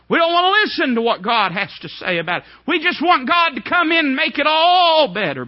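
high, loud preaching climax; preaching at full force. Acted. Preacher driving home John's despair, full-force preaching. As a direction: build and ring it out to the crowd.

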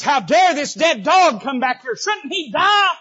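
high, loud, slow outraged cry; outraged demand. Acted. Abishai demands Shimei's death, loud and outraged. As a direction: rage at the dead dog. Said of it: "and he says".

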